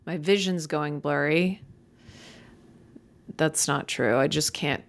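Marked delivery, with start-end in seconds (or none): none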